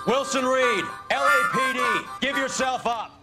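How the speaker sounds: loud, high-pitched shouted command; police shout. Acted. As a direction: call out to a fugitive to surrender. Police shout demand to fugitive.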